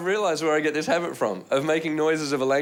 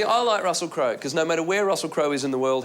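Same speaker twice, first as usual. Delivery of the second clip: ordinary talk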